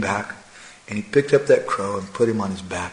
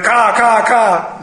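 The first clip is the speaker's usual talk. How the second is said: high, loud imitated crow calls; crow cawing; 10 semitones higher, 7 dB louder, much slower than usual. Happy brother crows watching the flying tricks, cawing. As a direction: squawk out three excited caws. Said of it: "they're going".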